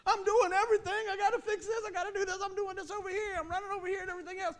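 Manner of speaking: high, frantic overworked complaint; mock yelling. Overworked church member to everybody, high frantic mock yelling. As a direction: yell it high and nonstop, easing in volume. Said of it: "You're too busy yelling at everybody".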